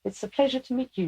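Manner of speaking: high, quick, squeaky greeting; Leica. Acted. Channeled lieutenant greets the granddaughter in a squeaky voice. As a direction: rattle off a polite hello, high-pitched. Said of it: "somebody that has a very squeaky voice".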